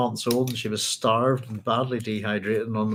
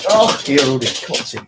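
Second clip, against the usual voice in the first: calling the dog; 6 dB louder than usual. Real, not acted.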